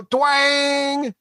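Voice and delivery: high sung twang of a bowstring. Acted. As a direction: twang it like a plucked bow. GM voicing a bow shot.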